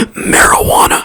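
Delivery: loaded, voiceless rasp on one word; mock-scandalized, strained unvoiced delivery; hammed-up reveal. Presenter revealing what the devil's grass is, hammed-up rasp.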